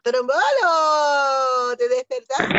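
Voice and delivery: excited call. Acted. Playful wake-up call to puppet.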